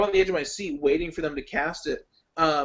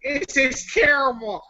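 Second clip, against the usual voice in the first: high, drawn-out character voice; loud, slow, hammed-up review verdict; Popcorn King; 8 semitones higher, 4 dB louder, slower than usual. Shown as a demonstration. Joking movie review in someone else's voice, high and hammed-up. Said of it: "do it as a Popcorn King voice".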